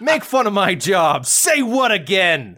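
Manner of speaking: high, slow mock-menacing challenge; hammed-up tough-guy movie threat; Samuel L. Jackson. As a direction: dare them, drawing the words out. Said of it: "Like he's Samuel L. Jackson being like".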